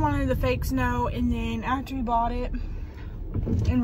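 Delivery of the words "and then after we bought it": ordinary delivery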